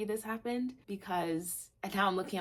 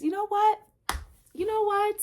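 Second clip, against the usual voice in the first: much higher and louder, elated; squealing with real excitement; excited; 9 dB louder than usual. Real, not acted. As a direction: burst out with sudden joy. Sudden high elated outburst.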